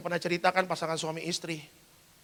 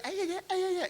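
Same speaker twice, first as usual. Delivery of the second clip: chattering wife. Retold or imitated. Wife gossiping with other couples at retreat, mock chatter. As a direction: imitate a woman babbling away with friends. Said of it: "gitu-gitu kan".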